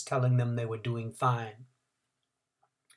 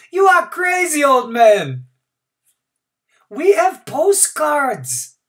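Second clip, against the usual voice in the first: derisive shout; 12 dB louder, slower than usual. A narrator's reading. Crowd laughs at old man.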